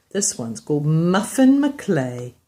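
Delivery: plain reading voice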